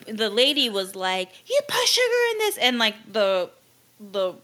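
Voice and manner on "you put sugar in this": very high imitation of an irate customer; mock outrage. Imitating the lady accusing the Starbucks employee, mock outrage. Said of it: "she's, like, freaking out".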